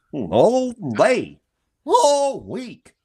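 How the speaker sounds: high, whooping cartoon voice; hammed-up cartoon goof, pitch leaping up; Goofy. Joking about being stuck in character, high cartoon voice. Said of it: "the whole day you talk like".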